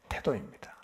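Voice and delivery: 속삭이듯 힘주어; hushed, intense whisper to the congregation. Real, not acted. Preacher drops to whisper for emphasis. (속삭이는 목소리로 강조)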